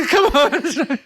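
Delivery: high, loud plea through laughter; laughing mock exasperation. Dad re-enacting his reaction to her not listening, laughing exasperation. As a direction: plead in hammed-up, giggly exasperation.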